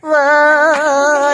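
वाह stretched very long and high, sung out like a goat; mock the child's bleating वाह. Teacher mocking kids who drag वाह like goats, long sung bleat. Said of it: "मैं सब बकरियों को पढ़ाता हूँ".

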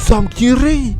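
angry snap. Real, not acted. Snapping at someone mid-game.

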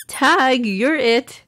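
cheerful, playful call. A narrator's reading. Mama starts a game of tag with Baba, cheerful. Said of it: "she said cheerfully".

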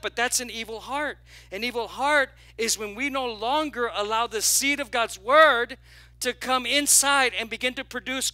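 loud, driving sermon climax; building preaching. Acted. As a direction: press the point home to the congregation. Preaching at full force.

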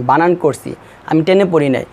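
usual speaking voice

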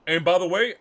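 loud and heated. Real, not acted. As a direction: cut in loudly to defend himself. Defending himself to Nikki, heated.

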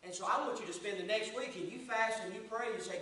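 normal talking voice

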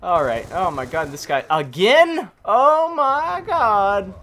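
loud, building to a high, drawn-out wail; real frustrated shouting; exasperated outburst. Real, not acted. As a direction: build from annoyed to yelling in disbelief. Killed again by same guy.

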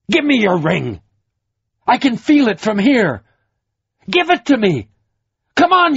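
furious demand. A narrator's reading. Troy berates Covenant for the ring.

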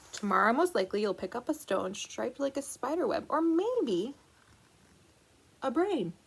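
lively picture-book read-aloud; reading to children. Acted. Librarian reading the book to children, lively storytelling. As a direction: read it to a room of little kids.

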